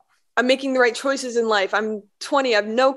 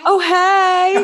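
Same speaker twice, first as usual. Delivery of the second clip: excited greeting; 9 semitones higher, 7 dB louder than usual. Real, not acted. Greeting a shy child.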